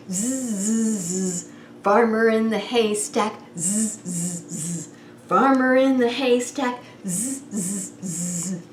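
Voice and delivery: put-on snore gently for the kids; playful sleepy snoring. Farmer snoring in song.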